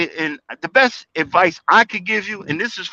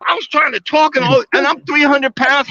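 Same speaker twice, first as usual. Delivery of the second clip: ordinary talk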